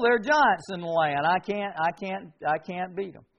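normal talking voice

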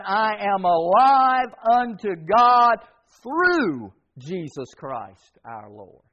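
slow, with THROUGH leaned on and drawn out; stress on through. Shown as a demonstration. Preacher reading the verse to his church, slowly stressing through. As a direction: stretch out "through" so it lands heavy. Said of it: "Emphasis on through".

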